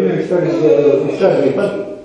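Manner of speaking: ordinary talk, nothing marked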